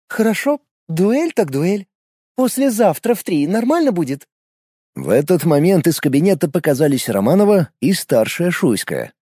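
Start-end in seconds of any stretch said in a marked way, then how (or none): 0.1-4.3 put-on pleased young woman. A narrator's reading. Katya accepts duel smiling.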